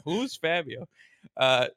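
ordinary talk

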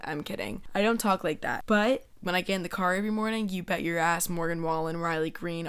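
normal talking voice

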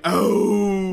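big mock "oh" of pain; imitated groan. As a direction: groan it out like a hurt man, hammed-up. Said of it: "like a grown man, like".

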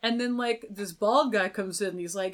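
usual speaking voice

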